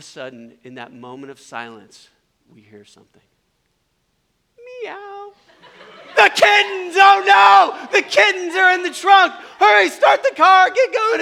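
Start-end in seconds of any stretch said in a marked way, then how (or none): 4.8-5.3 tiny high-pitched meow; small, soft, high mewing; kitten meow. Retold or imitated. Kitten in the trunk after the crash, tiny meow. 6.2-11.2 very high, loud frantic cries; hammed-up shriek of sudden alarm; panicked shrieking. Friends remembering kittens in the trunk, panicked shrieking.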